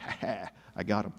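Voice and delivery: soft, low villain's chuckle and gloat; mustache-twirling villain. Acted. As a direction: gloat under your breath like a vaudeville villain. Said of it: "twirling his mustache".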